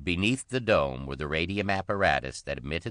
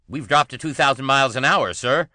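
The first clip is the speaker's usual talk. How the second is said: much higher, louder urgent call; 10 semitones higher, 10 dB louder than usual. A narrator's reading. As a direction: shout the bad news across. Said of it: "called Brent".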